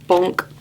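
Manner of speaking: low comic bonk; a silly low bonk sound. Acted. Sound effect for a fall.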